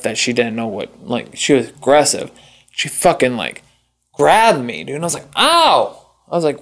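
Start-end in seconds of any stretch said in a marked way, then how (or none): 5.4-6.1 high-pitched "ow"; retold yelp of pain. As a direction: imitate a startled cry of pain. Retells being grabbed painfully.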